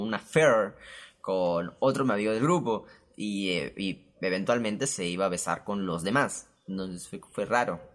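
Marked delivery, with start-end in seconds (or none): none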